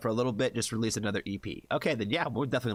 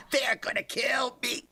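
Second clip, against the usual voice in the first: laughing; 14 semitones higher than usual. Real, not acted. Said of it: "laughing at own fear".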